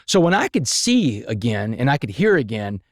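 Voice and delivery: normal talking voice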